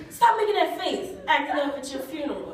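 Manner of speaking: high mock scold; scolding aunt. Aunt scolding the corpse at the funeral, high mock scold. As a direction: snap at him like an exasperated aunt.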